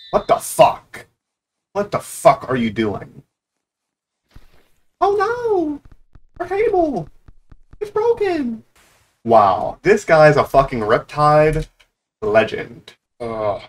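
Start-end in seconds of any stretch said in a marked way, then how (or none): 5.0-8.6 squeaky, put-on alarm; high-pitched dismay. Gamer lamenting the broken table, high-pitched dismay. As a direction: cry out far above your usual pitch.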